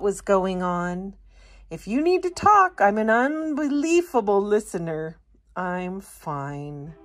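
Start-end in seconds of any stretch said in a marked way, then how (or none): 1.7-5.2 much higher, earnest offer; a helpful friend leaning in; high chirpy helper voice; eager listener friend. Acted. 5.5-7.0 much softer, lower I'm fine; not wanting to talk; quiet sulky sprout; mumbled brush-off. Acted.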